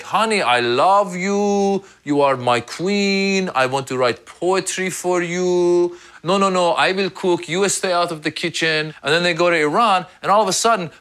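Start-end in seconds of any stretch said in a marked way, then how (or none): 0.0-8.9 slow, high, syrupy romantic husband; sweet loving husband. Retold or imitated. Husband sweet-talking his American wife, slow and syrupy. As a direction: woo her slowly and sweetly. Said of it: "He's like".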